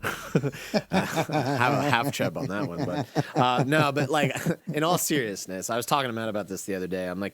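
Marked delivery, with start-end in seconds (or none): 0.0-2.8 laughing. Real, not acted. Laughing after the surprise.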